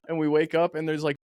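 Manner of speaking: normal talking voice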